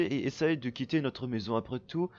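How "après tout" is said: ordinary talk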